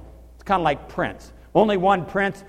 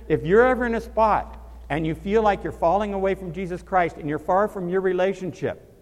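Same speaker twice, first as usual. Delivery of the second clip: usual speaking voice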